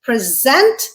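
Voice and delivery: "present" as pre-ZENT, weight on the end; stress on second syllable. Shown as a demonstration. Teacher shows the verb, "present" stressed on SENT. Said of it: "the way I pronounced it".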